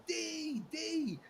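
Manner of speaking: high calls of his name; calling out. Retold or imitated. Fans on the street greeting [name], high calls. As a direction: call out like a fan spotting him.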